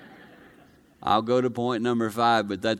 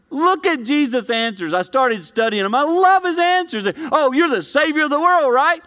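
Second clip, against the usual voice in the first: much higher and louder, building fervor; full-force preaching; 13 semitones higher, 6 dB louder than usual. Acted. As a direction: preach it loud, climbing.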